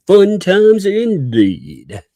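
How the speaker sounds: drawn-out, high, loud Fun times indeed; playful slow emphasis; mock-grand echo. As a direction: savor each word like a toast. Echoing chat, drawn out high.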